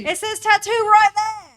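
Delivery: high, very loud mocking exclamation; loud mock Brit. Echoing her British friend's loud outburst, loud imitation. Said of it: "well-known loudness".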